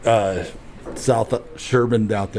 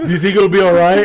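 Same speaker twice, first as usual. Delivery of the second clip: higher, louder and fast, a mock-panicked mother; worried mom; 6 semitones higher, 6 dB louder, faster than usual. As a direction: rattle it off like a frantic mom.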